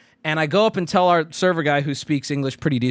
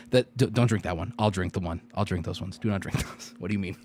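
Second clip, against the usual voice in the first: 1 hushed panicky warning; 10 semitones lower, 7 dB quieter than usual. Pretend. Panicked warning to friends at bar.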